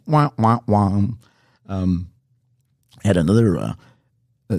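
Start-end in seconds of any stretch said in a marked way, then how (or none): 0.1-1.0 sad trombone. Retold or imitated. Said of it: "mock sad trombone".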